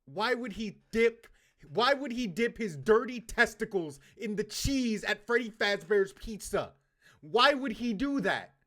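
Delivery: high, loud, incredulous rant; mock outrage. Streamer berates a pizzeria villain, in mock outrage. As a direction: demand answers in hammed-up outrage.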